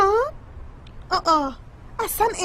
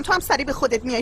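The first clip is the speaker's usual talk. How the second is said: ordinary delivery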